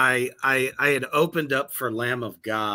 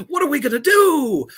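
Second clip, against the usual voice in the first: high, loud, wailing panic; mock panic; 14 semitones higher, 8 dB louder than usual. Band members panicking about meeting Lamb of God, mock wailing. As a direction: wail it like the band is doomed.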